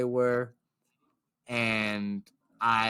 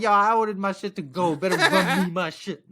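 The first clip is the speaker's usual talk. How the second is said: loud, higher joking bluster; mock demand; 5 semitones higher, 5 dB louder than usual. Customer jokingly demands his to-go order, mock bluster. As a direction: demand it with a laugh. Imagined demand, retracted with nah.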